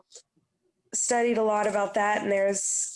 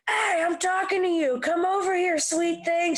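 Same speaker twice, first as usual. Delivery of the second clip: put-on high, leering drunk catcall; gross drunk; 7 semitones higher than usual. Drunk man at her gig catcalling the singer. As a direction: leer at her like a sleazy drunk.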